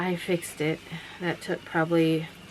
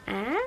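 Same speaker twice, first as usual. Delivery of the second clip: high, rising little "ah"; short upward child noise; imitating child's noise; 4 semitones higher than usual. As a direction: mimic a teasing toddler's questioning squeak. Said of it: "she kept going".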